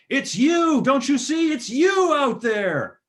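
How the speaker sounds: high frantic screaming, dragged out. Retold or imitated. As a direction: yell it in sheer panic. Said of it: "Leslie Nielsen's screaming".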